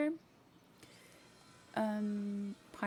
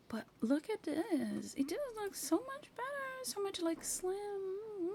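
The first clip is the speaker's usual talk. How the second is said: high, thrilled gush; much higher excited gushing; delighted; 11 semitones higher than usual. Real, not acted. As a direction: squeal over how sleek it looks. Thrilled at sleek layout.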